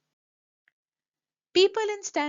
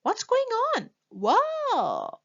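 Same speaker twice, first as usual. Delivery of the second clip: put-on surprised boy; 11 semitones higher, 4 dB louder, slower than usual. Boy slipping on candy.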